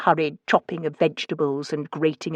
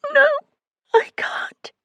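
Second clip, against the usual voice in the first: breathless, tearful refusal; weeping; slower than usual. A narrator's reading. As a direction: friend weeps down the phone. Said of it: "she wept".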